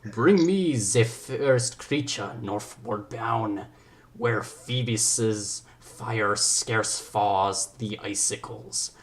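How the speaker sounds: put-on very slow, theatrical French accent; drawn-out amateur French-accented Shakespeare; attempted French accent. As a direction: declaim grandly in a shaky attempt at French. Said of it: "I'm gonna go for a French accent".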